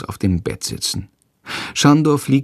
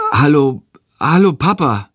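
stunned hello; 5 semitones higher than usual. Acted. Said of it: "sagt er verdattert".

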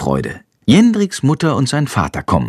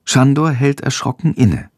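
ordinary delivery, nothing marked

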